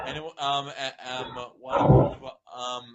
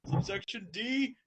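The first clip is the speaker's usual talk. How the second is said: Spike voice; 7 semitones higher, 10 dB louder than usual. Acted. Reading Spike's lines aloud to the group, in a lightly voiced dragon tone. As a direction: read it as young Spike. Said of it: "I will be playing the role of Spike".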